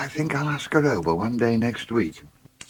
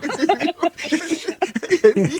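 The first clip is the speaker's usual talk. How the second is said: high laugh; 4 dB louder than usual. Real, not acted. Big laugh at Joe's excuse.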